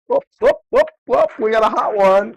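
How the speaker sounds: high, loud whoop whoop cheer; excited whooping. Real, not acted. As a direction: hype it up like a siren. Excited about a hot number.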